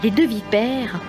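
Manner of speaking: usual speaking voice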